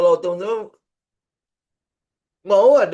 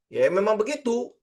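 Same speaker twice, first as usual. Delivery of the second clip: airy, offhand yes it's just that simple; breezy dismissal; 6 semitones higher than usual. Shown as a demonstration. Teacher mimics a trader's breezy it's-that-simple. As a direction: toss it off as if it's obvious. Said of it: "ngomongnya simple kan?".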